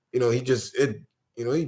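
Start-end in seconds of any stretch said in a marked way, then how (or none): none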